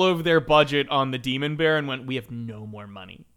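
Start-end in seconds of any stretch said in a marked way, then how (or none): 2.0-3.3 much lower, hushed letdown; quiet, low mock resignation; mock deflated. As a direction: drop right down like broke filmmakers admitting it. Acting out broke filmmakers.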